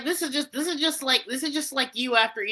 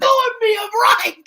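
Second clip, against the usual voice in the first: mock Irish shout; 10 semitones higher, 8 dB louder than usual. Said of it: "was that Irish?".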